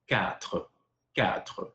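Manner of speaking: "Quatre" with a guttural final R; throaty French R. Shown as a demonstration. Teaching four in French, throaty R. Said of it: "This one has a R".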